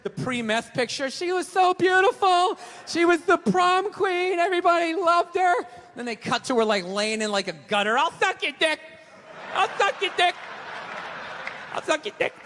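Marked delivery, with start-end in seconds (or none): 1.1-5.5 high, loud, slow wistful voice; mock sentimental narrator. 8.0-12.3 addict. Pretend. Addict in the gutter.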